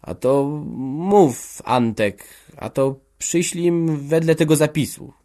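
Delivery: faltering, passing the word along; stammering. A narrator's reading. Blacksmith's wife hesitates before father, stammering. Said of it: "jąkała Kowalowa".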